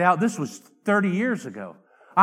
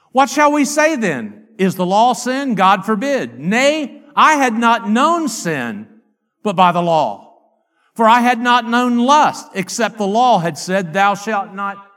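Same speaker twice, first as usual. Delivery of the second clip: loud, declamatory Bible reading; scripture reading; 7 dB louder, slower than usual. Real, not acted. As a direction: proclaim the verse firmly to the congregation. Reads scripture aloud forcefully.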